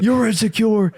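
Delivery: high, sneering retort; mocking comeback. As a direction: throw it back at him mockingly. Talking back, retold argument.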